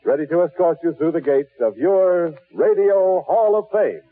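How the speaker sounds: ordinary delivery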